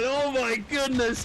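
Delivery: very high loud oh my goodness; excited shout. Real, not acted. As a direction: scream oh my goodness in awe. Shouted reaction.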